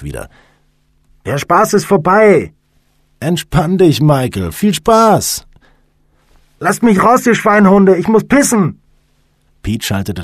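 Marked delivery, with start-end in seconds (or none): 1.3-2.5 slow, high, loud yell; protesting shout. A narrator's reading. Trapped man yells from coffin. 3.2-5.4 high, gleeful mocking call; raised voice, rubbing it in; loud taunting. A narrator's reading. Prankster taunts trapped friend by radio. 6.6-8.8 high-pitched, full-volume shouting; frantic high holler at the pranksters; desperate angry yell. A narrator's reading. Trapped man begs to be let out.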